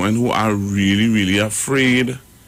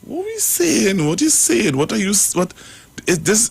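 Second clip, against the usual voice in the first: much higher, outraged questioning; indignant disbelief; 9 semitones higher than usual. Real, not acted. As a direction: demand an answer, appalled. Indignant after hearing the clip.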